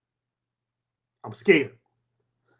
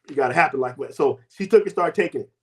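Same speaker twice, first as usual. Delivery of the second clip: ordinary delivery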